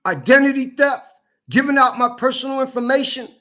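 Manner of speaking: high, heated list of charges; angry accusation. Real, not acted. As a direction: fire off each offence with outrage. Angrily listing the offences against him.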